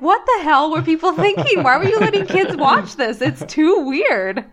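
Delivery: high, loud disbelief; shocked at what kids were shown; incredulous. Retold or imitated. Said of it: "and I'm like".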